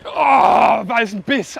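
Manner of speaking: loud, slow excited shout. Real, not acted. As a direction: yell it out, thrilled. Another big bite.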